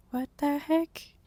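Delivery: loud, slow shocked cry. Real, not acted. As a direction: blurt it out, amazed. Visitor count jumps.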